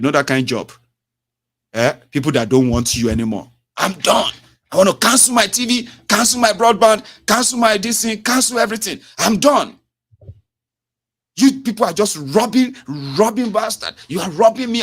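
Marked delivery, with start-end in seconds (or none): 3.8-14.8 high, loud furious customer demanding to cancel; angry customer. Retold or imitated. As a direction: play the irate caller yelling at the agent, much higher and louder.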